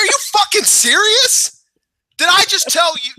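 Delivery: high, disbelieving squeal; shrill disbelief at the neighbor; mock outrage. Telling his friend, a high disbelieving squeal. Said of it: "I was like".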